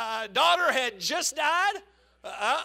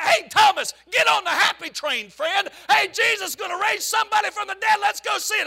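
loud, higher hail to a friend; calling out; 6 semitones higher, 4 dB louder than usual. Retold or imitated. Preacher calls Thomas to join, loud cheerful hail. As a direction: shout him over to the happy train.

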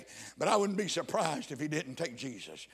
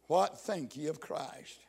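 usual speaking voice

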